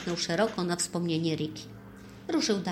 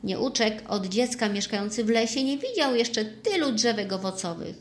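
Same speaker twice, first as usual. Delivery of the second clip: usual speaking voice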